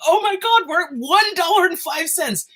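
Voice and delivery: high, loud, astonished outburst; real excitement. Real, not acted. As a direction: exclaim in shock at the plunging price. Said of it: "I'm so excited".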